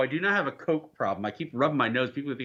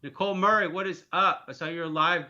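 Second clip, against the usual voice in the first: usual speaking voice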